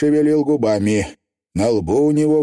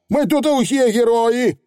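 squeaky, eager pixie chief; 12 semitones higher than usual. Acted. Feegle boasting.

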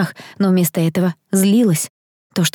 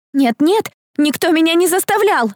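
put-on high-pitched, protesting girl; exclaiming; 11 semitones higher than usual. A narrator's reading. Girl denies being forced to lie, high exclamation. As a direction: cry out a panicky denial. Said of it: "воскликнула она".

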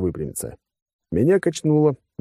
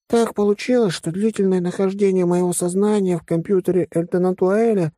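high-pitched little creature voice; furry creature, thoughtful; 11 semitones higher than usual. Acted. Furry Vasya explains his two selves to friends, thoughtful. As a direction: explain it all in a high put-on voice.

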